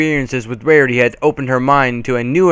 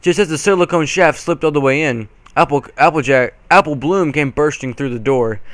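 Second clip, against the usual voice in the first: plain reading voice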